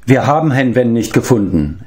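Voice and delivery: ordinary delivery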